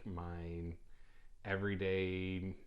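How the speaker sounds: ordinary talk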